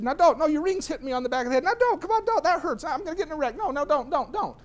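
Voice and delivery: high, loud acted pleading; frantic protest. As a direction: escalate into a high-pitched panicky yell. Escalating protest while driving, acted.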